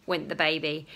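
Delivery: ordinary delivery, nothing marked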